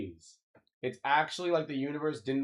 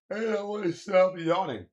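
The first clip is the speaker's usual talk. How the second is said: higher and louder put-on whine; goofy high complaint; mock whiny voice; 8 semitones higher, 6 dB louder than usual. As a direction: squeal it like a silly character. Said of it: "repeats it in a plain voice".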